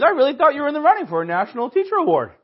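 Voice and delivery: high, loud, fast disbelief; retelling a fooled coworker, excited rush. Retold or imitated. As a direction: gush it like you totally bought it. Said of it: "he was excited".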